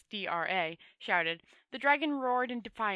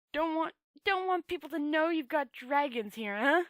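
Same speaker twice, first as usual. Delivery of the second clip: snarling; 5 semitones higher, 4 dB louder than usual. A narrator's reading. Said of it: "snarled Rincewind".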